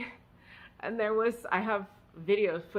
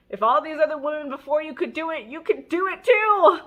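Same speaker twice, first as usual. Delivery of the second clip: rallying pep talk; 7 semitones higher, 8 dB louder than usual. Retold or imitated. Friend cheering on crying mom.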